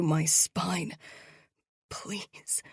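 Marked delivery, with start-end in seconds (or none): 1.9-2.6 soft, breathy whispered please. A narrator's reading. Parched prisoner whispering please, cracked lips. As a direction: barely breathe the word. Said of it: "I whisper again".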